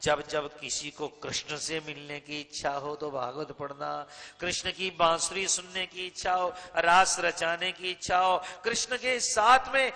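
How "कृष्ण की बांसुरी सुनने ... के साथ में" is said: building louder and higher to a question; mounting exhortation that asks for an answer; rousing preaching. Real, not acted. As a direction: work the congregation up item by item.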